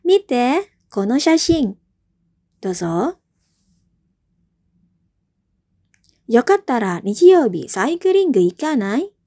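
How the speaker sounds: teacher playing A in the drill; role A in drill. Shown as a demonstration. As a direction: play A for the class. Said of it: "saya dulu ya, sebagai A".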